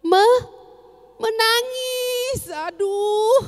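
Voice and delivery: drawn-out, high mock wail of a crying child. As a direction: ham up a child crying in the morning, slowly. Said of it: "dia seperti ini, coba lihat".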